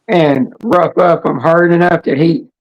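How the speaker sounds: usual speaking voice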